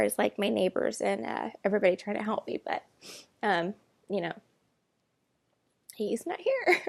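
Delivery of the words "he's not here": a real catch in the throat, pitch jumping up; holding back tears and barely getting it out; voice breaking. Real, not acted. Talking about her missing cat, voice cracking with tears. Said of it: "I'm getting a little upset".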